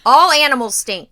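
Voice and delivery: loud, fed-up declaration; loud rant. Real, not acted. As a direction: declare it loudly in disgust. Ranting about pet smell.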